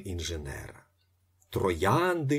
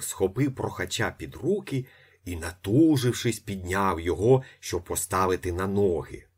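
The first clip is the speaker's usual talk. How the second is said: ordinary delivery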